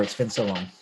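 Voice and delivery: ordinary talk, nothing marked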